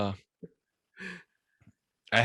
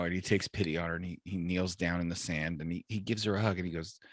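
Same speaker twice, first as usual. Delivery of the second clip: normal talking voice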